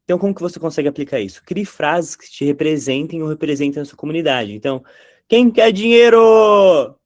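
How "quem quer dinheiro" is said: high, loud, slow showman shout; TV host catchphrase. Retold or imitated. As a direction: belt it like a TV game show host. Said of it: "de quem vocês lembram". (grito de apresentador de auditório, agudo, alto e arrastado)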